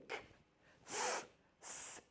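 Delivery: "K" and "S" as bare hissed sounds, no vowel; exaggerated unvoiced sounds. Shown as a demonstration. Teacher exaggerating unvoiced consonants. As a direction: stretch the S out breathy and soft. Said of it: "of course I'm exaggerating".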